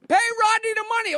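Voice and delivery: taunting him from the sidewalk; heckling fans. Retold or imitated. Strangers on the street heckling Jackie, mocking shout. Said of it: "people like".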